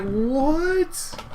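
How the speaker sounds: long, high-pitched rising what; incredulous cry. Retold or imitated. Reacting to the cop's prank, high-pitched incredulity. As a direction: stretch it upward in utter disbelief.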